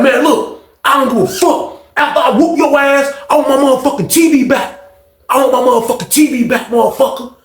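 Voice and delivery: high-pitched acted threat; angry threat. Fonz demands his T V back, imitated in high anger.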